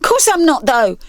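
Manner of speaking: high, sharp retort; snapping girl. Acted. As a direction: bite back. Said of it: "she snapped back".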